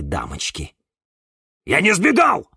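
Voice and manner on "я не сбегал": high, loud denial; indignant roar. A narrator's reading. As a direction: shout your innocence indignantly. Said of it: "рявкнул он". (возмущённо рявкает)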